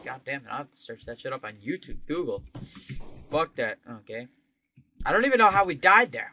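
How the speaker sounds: ordinary delivery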